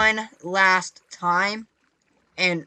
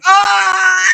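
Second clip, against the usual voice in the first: loud, high scream; sudden sustained yell of fright; startled scream; 10 semitones higher, 10 dB louder than usual. Real, not acted. Gamer startled while placing water, loud startled scream.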